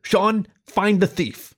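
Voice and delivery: Perry ordering Sean to find the culprit; commanding rat. Acted. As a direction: lay it down as a grand command.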